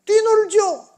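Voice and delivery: high, loud joyful burst; exuberant. Acted. Preacher tells how the righteous leap before God, exuberant. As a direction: leap up on the words.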